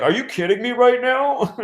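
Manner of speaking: high-pitched retold indignation; retold angry disbelief. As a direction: imitate an incredulous, angry passenger. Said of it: "this guy just got so angry".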